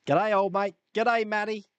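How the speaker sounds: light Australian greeting; a hint of an Australian accent; lightly voiced Aussie hello. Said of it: "start speaking like an Australian".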